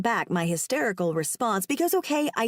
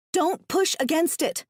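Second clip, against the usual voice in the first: urgent, sharp warning, pitched up; 8 semitones higher than usual. Acted. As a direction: warn him not to move. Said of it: "I warn".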